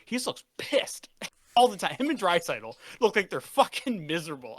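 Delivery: loud, high-pitched rant; real heated sports-fan outburst; fired up. Real, not acted. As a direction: get worked up, punching the key words. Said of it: "I'm sorry, I'm fired up".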